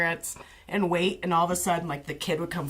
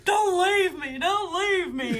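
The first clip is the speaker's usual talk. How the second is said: much higher, slow hammed-up crying plea; mock sobbing mom; 12 semitones higher, 3 dB louder, slower than usual. Mom at the ambulance, high slow mock sobbing. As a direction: sob it melodramatically. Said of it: "The mom is just like".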